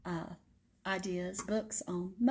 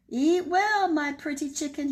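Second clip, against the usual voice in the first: higher, louder wolf call; wolf crying out; 7 semitones higher, 5 dB louder than usual. Acted. Wolf calling to the chicken over the cake, higher and louder. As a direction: call it out eagerly to the chicken's house. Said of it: "he cried".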